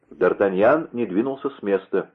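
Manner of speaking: plain reading voice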